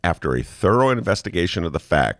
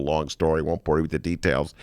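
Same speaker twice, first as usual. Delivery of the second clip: ordinary talk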